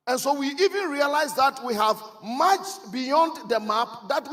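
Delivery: loud, high sermon storytelling; emphatic preacher's delivery, building in force; full-force preaching. Real, not acted. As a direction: preach it out to the whole congregation. Preacher tells story, drives home message.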